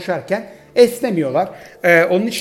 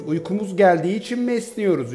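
ordinary delivery

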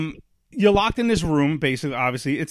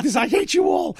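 high-pitched comic shout of frustration; shrill retold tantrum; mock meltdown yell; 12 semitones higher than usual. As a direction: scream at the family, hammed up. Retold outburst at family.

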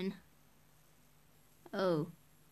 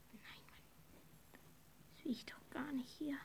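whispering; 2 semitones lower than usual. Real, not acted. Embarrassed whispered asides to viewers.

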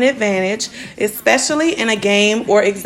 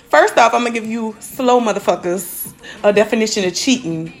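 ordinary talk, nothing marked

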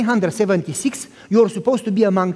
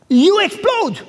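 pitched far higher and louder, bursting out; preacher exploding on explode; exclaimed climax; 11 semitones higher, 3 dB louder, slower than usual. Acted. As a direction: let it burst like fire.

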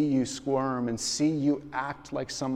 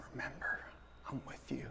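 softer, near-whispered comfort; soft reassurance; 14 dB quieter, faster than usual. Retold or imitated. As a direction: reassure gently, close by. Jesus comforting, softly voiced.

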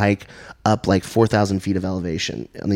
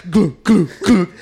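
imitated glugging, higher; glug sound; 10 semitones higher, 3 dB louder, slower than usual. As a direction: mimic liquid pouring. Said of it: "So I'm like".

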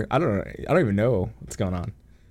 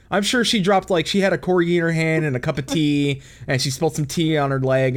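ordinary talk, nothing marked

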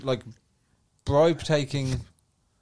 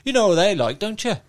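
ordinary talk

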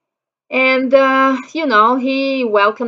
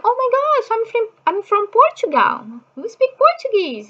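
very high-pitched imitation of a thrilled woman; retold delighted gush; 13 semitones higher than usual. Portuguese woman meeting a fellow speaker, imitated high excited gush. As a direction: squeal it lightly, as her happy surprise.

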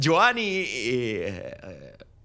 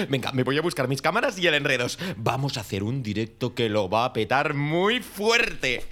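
ordinary talk, nothing marked

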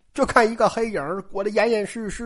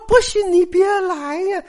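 high-pitched, teasing challenge; coy, high-voiced goading; taunting dare; 8 semitones higher than usual. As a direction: dangle it as a take-it-or-leave-it dare. Woman dares eager suitor.

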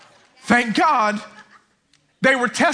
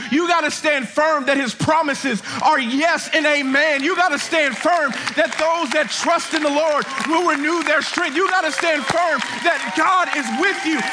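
high, insistent preaching voice; full-force preaching; 6 semitones higher than usual. Acted. Preacher urging the congregation to stand firm, fervent preaching. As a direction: drive each promise home from the pulpit.

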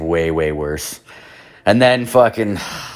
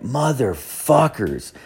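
slow bitter curse; 4 semitones higher, 4 dB quieter, much slower than usual. Retold or imitated. Finding car ripped apart.

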